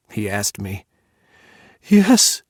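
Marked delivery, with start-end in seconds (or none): 1.8-2.4 a high, loud, desperate yes; a lover begging to be allowed to come. Acted. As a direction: blurt it out, pleading and desperate. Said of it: "I answered desperately".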